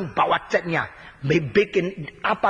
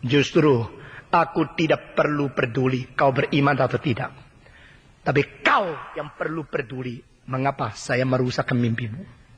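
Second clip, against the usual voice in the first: voice of God; 6 semitones lower than usual. Acted. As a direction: answer calmly from above. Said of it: "Tuhan mengatakan".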